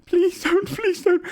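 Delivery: tiny high-pitched begging; pleading gnome. Acted. Frightened gnome in the corner begging, very high voice. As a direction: squeak out a plea. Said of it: "Miles is like".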